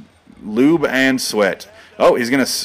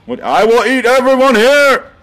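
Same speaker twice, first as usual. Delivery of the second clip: roaring monster wrestler; 9 semitones higher, 5 dB louder than usual. Pretend. Commentator voicing the champion roaring at the crowd. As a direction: roar it like a cartoon monster.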